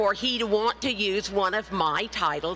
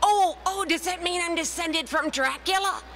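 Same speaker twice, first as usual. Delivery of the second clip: excited gasp; 9 semitones higher than usual. Retold or imitated. Asking her cousin about Dracula, excited and much higher. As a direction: burst out thrilled at the news. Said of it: "I said".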